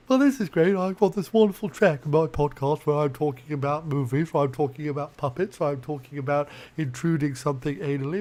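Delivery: quiet, smug imagined listener; a hint of a pompous know-it-all; mock posh podcaster. Voicing the viewer with a finished podcast, softly smug. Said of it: "and now you're like".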